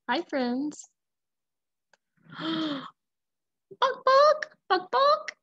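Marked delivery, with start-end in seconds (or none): 2.4-5.3 gasp, then high loud chicken squawks; panicked hen calling out; alarmed clucking. Acted.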